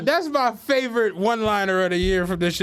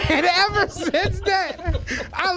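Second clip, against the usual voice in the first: laughing; 7 semitones higher, slower than usual. Real, not acted. Laughing at the story.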